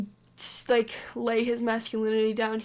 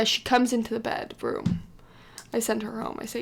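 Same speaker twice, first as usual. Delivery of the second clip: ordinary delivery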